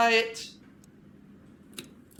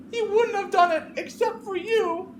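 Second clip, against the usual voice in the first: much higher, much louder accusing scream with a cry; Clarissa screaming; 6 dB louder than usual. Acted. Grieving Clarissa accuses Veronica through the locked door, screaming. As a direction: hurl it at her like she murdered him.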